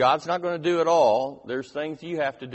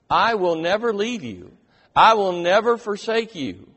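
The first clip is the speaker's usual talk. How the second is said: "I will never leave you" rising loud and higher; proclaimed loudly; 5 semitones higher, 7 dB louder than usual. Shown as a demonstration. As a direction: declare "never forsake you" with force.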